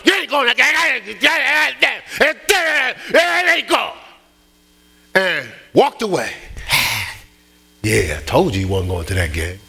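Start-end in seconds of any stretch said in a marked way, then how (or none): 0.0-3.9 high, frantic mock yelling; forceful shout, acted out. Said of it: "I said it with might". 7.8-9.6 smug. Retold or imitated. Self-satisfied muttering after walking away.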